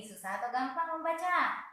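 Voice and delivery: normal talking voice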